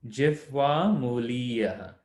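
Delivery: slow, careful jifwa mulia; careful Sanskrit. Shown as a demonstration. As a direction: say "Jifwa mulia" slowly, each sound clear. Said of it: "notice there's a ha and a va".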